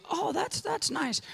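put-on high, soft, pleased old lady. Old lady hearing of the crusade, high and soft. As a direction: say it sweetly like a grandma.

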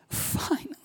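whispered, weight lifting off; relieved whisper. Retold or imitated. Pastor recalling confession, whispered relief. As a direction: breathe it out in relief. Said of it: "this weight was being lifted".

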